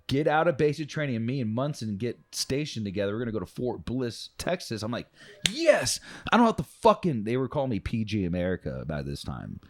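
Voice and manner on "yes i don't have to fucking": high, loud burst of joy; excited cheer. Retold or imitated. Recruit celebrating his escape from duty, loud and high. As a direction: cheer it like you got away with something. Retold joy at new posting.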